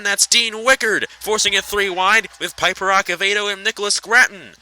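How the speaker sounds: brisk, lifted race-call voice; race play-by-play. Real, not acted. Race caller calling the truck race for viewers, lively play-by-play.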